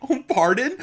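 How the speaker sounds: high, slow, disbelieving pardon; high-pitched mock bewilderment; baffled surprise. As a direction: act out being baffled by the question. Retold reaction to odd question.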